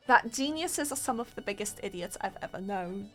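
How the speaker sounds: ordinary talk, nothing marked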